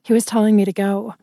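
plain reading voice

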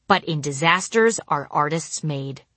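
plain reading voice

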